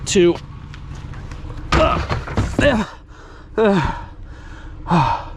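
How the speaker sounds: grunting with effort mid-lift; carrying heavy load. Real, not acted. Heaving battery into the Jeep.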